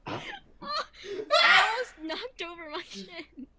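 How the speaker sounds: high, slow words through laughter; crying laughing. Real, not acted. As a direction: wheeze it out laughing.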